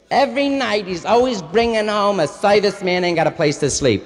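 kvetching Yiddish mama; Yiddish accent. Retold or imitated.

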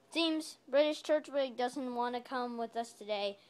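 normal talking voice

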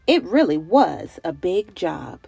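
ordinary delivery

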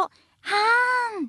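long, drawn-out breathy sigh; sweet breath sigh. Shown as a demonstration. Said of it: "セリフにすると".

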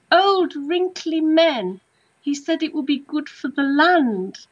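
high imitation of a lady in a castle, slow at first; regressed castle lady. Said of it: "I'll always remember how she said it".

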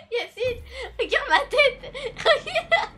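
high, loud laughing words; crying with laughter. Real, not acted. To Yacine after his joke, real helpless laughter. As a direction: laugh through every word, near tears. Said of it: "Je pleure de rire".

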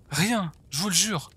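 high, urgent pleading; desperate denial. Acted. Captive swears to the torturer she took nothing, desperate. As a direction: plead innocence in a high, pressed voice.

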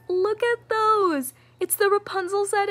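real excited gushing; thrilled. Real, not acted. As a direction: show off the earrings, thrilled. Showing off the earrings.

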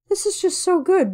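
gushing. Retold or imitated. Gushing over the video.

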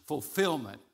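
normal talking voice

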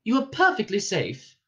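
high, pleasant stewardess voice; pleasant reassurance. A narrator's reading. Ship's voice calming Ford and Arthur, pleasant reassurance. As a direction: reassure like cabin crew amid disaster. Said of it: "said the voice pleasantly, like a stewardess".

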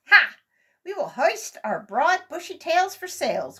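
high, loud and slow proud announcement; triumphant cry. A narrator's reading. Squirrel Brothers with their tail sail idea, triumphant. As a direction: announce the clever plan proudly. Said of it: "cried the Squirrel Brothers".

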